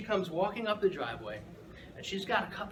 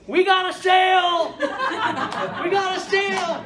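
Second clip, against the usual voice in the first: much higher and louder, yelling with glee; excited shout; 11 dB louder than usual. Retold or imitated. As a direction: shout the good news across the street. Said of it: "shouts across the street".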